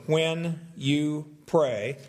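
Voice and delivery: very slow "When You Pray", stressed word by word; emphatic title. Shown as a demonstration. Pastor recalling sermon title to congregation, slow emphatic. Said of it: "put the word "you" in quotation marks".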